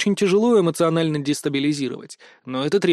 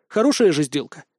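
usual speaking voice

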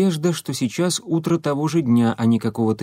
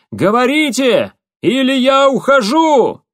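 high ringing yell, much louder; shouting over machinery; 12 semitones higher, 7 dB louder than usual. Acted. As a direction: shout a final ultimatum into the noise. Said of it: "С трудом перекрикивая шум машин".

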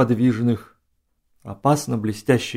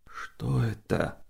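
slow, hushed question, much softer; whispered question; 3 semitones lower, 12 dB quieter, much slower than usual. A narrator's reading. General to his companion at the sleeping horses, whispered. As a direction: ask it slowly under your breath. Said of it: "прошептал генерал".

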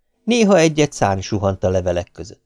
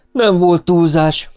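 slow, higher-pitched cheek; cheeky; 8 semitones higher, 4 dB louder, slower than usual. A narrator's reading. Tenzon teasing the girl who drove off, cheekily. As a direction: draw it out impudently. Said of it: "mondta Tenzon szemtelenül".

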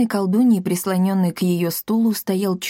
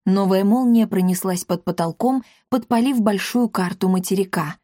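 plain reading voice

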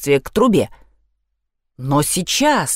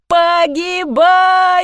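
wailing shout; 9 semitones higher, much slower than usual. A narrator's reading. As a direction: howl it in despair. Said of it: "не переставая при этом вопить".

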